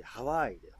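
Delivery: ハワイ with a loud, drawn-out English stress on ワ; English-style ハワイ. Shown as a demonstration. Correcting co-host's attempt, ハワイ with English stress on ワ. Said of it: "英語でハワイって言ってこない？".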